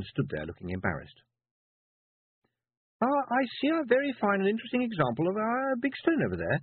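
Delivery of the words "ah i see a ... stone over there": put-on high, syrupy sweet old lady; sticky sweet voice. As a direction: lay the sweetness on thick. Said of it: "in a sticky, sweet voice".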